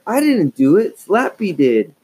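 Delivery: high loud denial; crying out. A narrator's reading. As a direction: insist she is innocent. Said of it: "Lindsay cried".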